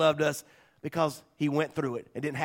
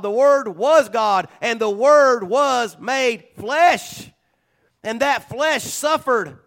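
full-force preaching; 8 semitones higher, 7 dB louder than usual. Acted. Pastor to congregation on the cross, preaching at full force.

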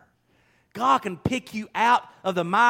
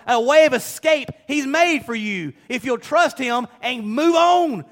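preaching louder and higher, slowing on the key words; rising preaching; 6 semitones higher, 6 dB louder than usual. Acted. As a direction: preach it with growing force toward move on. Preacher building to climax.

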